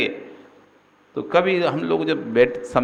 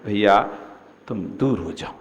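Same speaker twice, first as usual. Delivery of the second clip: loving tone; 5 semitones lower than usual. Shown as a demonstration. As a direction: ask him kindly to step aside. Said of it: "प्यार से बुलाकर बोल सकते हो".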